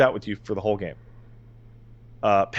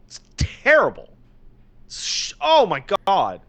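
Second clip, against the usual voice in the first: high, exasperated outburst; real high-pitched cry of disbelief; exasperated despair; 7 semitones higher than usual. Real, not acted. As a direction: wail the verdict in delighted despair. Exasperated verdict on beloved awful game.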